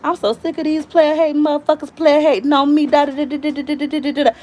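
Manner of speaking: high-pitched mock venting, running into babble; fed-up tirade, playfully exaggerated; acted cussing-out rant. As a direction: rant it out to the universe. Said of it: "You cuss them out into the universe".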